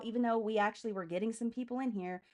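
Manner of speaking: usual speaking voice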